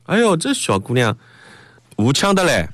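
high-pitched Shanghainese exclamation. Shown as a demonstration. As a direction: remark on the girl in animated Shanghainese. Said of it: "上海话两个字".

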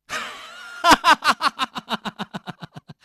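high scornful villain laugh fading out; mocking laugh. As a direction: laugh him off with contempt. (轻蔑的高声冷笑)

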